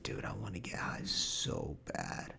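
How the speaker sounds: hushed whisper, no voice; whispered craving. Retold or imitated. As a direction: breathe it out secretly. Whispered wish beside sister.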